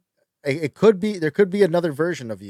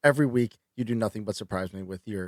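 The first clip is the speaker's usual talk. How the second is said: deadpan; 7 semitones lower, 6 dB quieter than usual. Acted. Deadpan mock accusation.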